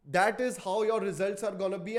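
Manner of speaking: normal talking voice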